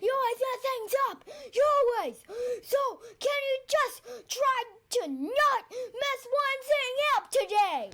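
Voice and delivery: high, loud mock tirade, fast then slowing; hammed-up angry yelling; yelling at him. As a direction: scold the friend who ruins everything. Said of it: "starts yelling at him".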